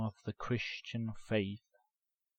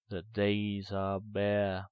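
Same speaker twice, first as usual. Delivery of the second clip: plain reading voice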